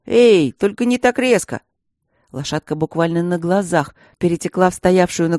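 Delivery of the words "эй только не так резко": alarmed warning. A narrator's reading. Professor warns the changing filly.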